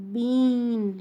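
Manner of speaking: "bean" with a long, drawn-out ee. Shown as a demonstration. Teacher showing the long sound, drawn-out E. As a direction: stretch the vowel in "bean". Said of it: "long sound of".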